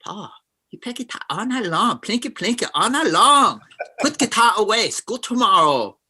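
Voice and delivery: put-on Korean-accented mom complaining about late-night guitar; Korean accent caricature. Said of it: "it's a caricature".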